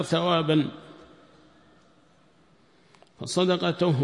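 measured dictation in separate phrases, firmly projected; deliberate, clearly projected dictation voice; teacher's dictation. Real, not acted. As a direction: dictate to students phrase by phrase, with pauses. Dictates explanation to students.

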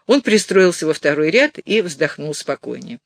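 ordinary delivery

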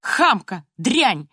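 high indignant cry dropping onto the insults; acted angry tirade; grandmother shouting. A narrator's reading. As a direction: scold loudly as an outraged grandmother. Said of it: "кричала бабушка".

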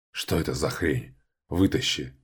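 low, strained croak; hoarse with pain. A narrator's reading. Wounded Valian begs Korhiy for help, hoarse with pain. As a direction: rasp it out while hurting. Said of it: "Вален хрипел от боли".

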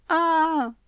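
high loud bawling wail; child's wailing scream. A narrator's reading. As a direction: let out a howl of a child. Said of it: "Девочка подняла рёв". (детский рёв)

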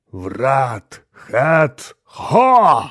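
very slow, loud, high chant; demonic incantation. A narrator's reading. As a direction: roar each word of the spell separately. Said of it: "прочитал главное демоническое заклинание". (громовое демоническое заклинание)

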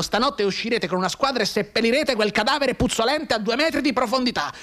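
shouting officer; furious colonel. Acted. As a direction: order the burial in a rage. Colonel orders burial.

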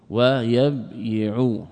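"ويبيع" as wa-yab-yi-u, very slow; underlying-form pronunciation. Shown as a demonstration. Teacher showing the origin, "ويبيع" slowly split wa-yab-yi'u. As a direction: break "ويبيع" after the b and sound the y. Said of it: "هذا الأصل".

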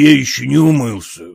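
plain reading voice

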